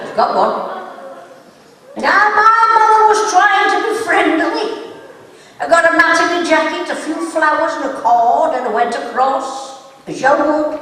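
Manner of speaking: ordinary talk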